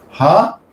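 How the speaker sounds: "Hare" broken into "Ha" and "re"; syllable split. Shown as a demonstration. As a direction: count out "Hare" as two separate beats. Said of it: "two syllables".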